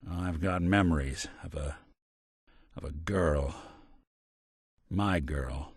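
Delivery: low, soft, halting recollection; softly haunted by a lost love; wistful remembering. Acted. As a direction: let the voice sink as the memory surfaces. Haunted recalling of a lost girl.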